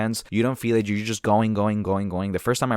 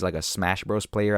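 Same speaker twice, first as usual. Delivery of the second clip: ordinary talk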